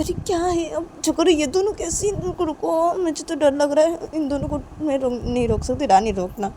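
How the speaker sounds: Ravi, nervous. Acted. Ravi too scared to stop the two, nervous.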